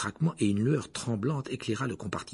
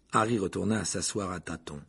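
ordinary delivery

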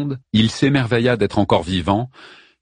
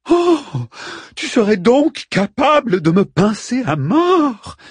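high, shrill mock fright, slow; feigned terror; 3 dB louder, slower than usual. Ariel mockingly pretending terror at Arman's threat. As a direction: fake being terrified, mocking. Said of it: "en feignant la terreur".

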